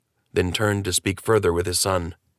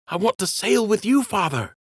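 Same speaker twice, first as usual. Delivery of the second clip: put-on much higher, small boyish voice; young prince pleading; 13 semitones higher than usual. Runaway prince pleading with his father, high young voice. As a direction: plead like a sniffling young rabbit.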